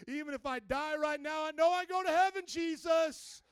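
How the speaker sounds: thanksgiving swelling to an exultant shout; fervent thankful prayer. Acted. Believer thanking God amid fear, building to fervent praise. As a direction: build into a high, loud cry of praise.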